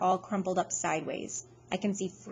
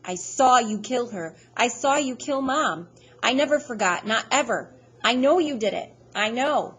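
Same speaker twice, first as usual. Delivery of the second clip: loud, raw accusation; a boy shouting at his father that he saw the killing, accusing; 5 semitones higher, 7 dB louder than usual. Acted. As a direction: throw the accusation right at him. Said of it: "and I'm going".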